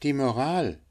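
high, unforced bird voice; patient linnet. Acted. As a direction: answer high, without raising the volume. Figure of the linnet. (geduldiger Hänfling, hoch gesprochen)

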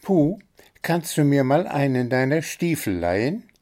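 high, eager little bird voice; excited chirping bird. A narrator's reading. Little bird pestering the gnome, high and eager. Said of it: "piepste Zwitschi aufgeregt".

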